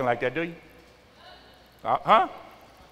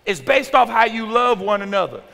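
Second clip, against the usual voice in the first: ordinary talk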